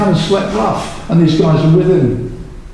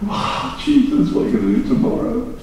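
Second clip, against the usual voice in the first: amazed disciples; 5 semitones higher, 5 dB quieter than usual. Retold or imitated. Voices amazed disciples after miracle.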